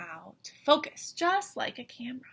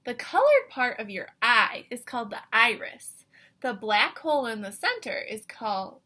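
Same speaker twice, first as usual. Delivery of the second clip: ordinary delivery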